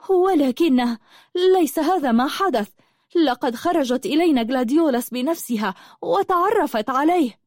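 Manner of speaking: high-pitched outburst of protest; crying out. A narrator's reading. Habiba defends the jailed friend, crying out. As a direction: cry out in protest, pitched well up. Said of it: "صرخت حبيبة".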